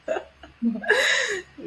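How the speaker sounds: high laugh. Real, not acted. Laughs at her own past.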